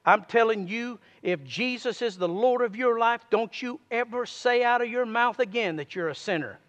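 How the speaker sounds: ordinary talk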